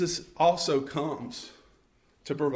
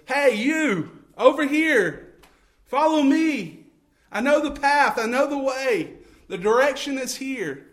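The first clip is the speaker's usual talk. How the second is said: high, loud calling voice; crying out; 8 semitones higher, 6 dB louder than usual. Pretend. A guiding voice calls to the lost hiker, loud and high. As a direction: call out across the dark woods. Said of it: "there's a voice that cries out and says".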